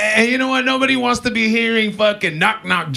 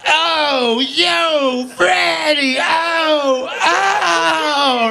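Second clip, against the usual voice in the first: mobster moaning; 8 semitones higher, much slower than usual. Pretend. Mob guy cries out to Freddie, hammed-up moaning yell. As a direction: wail it like a mock-sobbing mob guy. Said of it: "the soprano guy's like".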